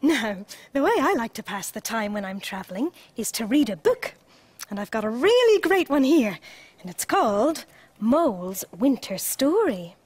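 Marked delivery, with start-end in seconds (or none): none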